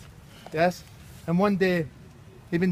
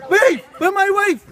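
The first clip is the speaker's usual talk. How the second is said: higher, louder, slowed "wait, where's my wife"; sudden alarm; 11 semitones higher, 7 dB louder than usual. Acted. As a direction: realize she's gone and call out. Husband realizes wife gone.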